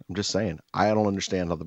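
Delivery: ordinary delivery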